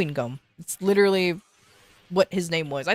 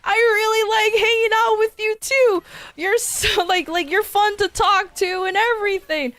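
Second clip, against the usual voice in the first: cheerful friend-zoning; 10 semitones higher than usual. Retold or imitated. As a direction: gush it happily, unaware.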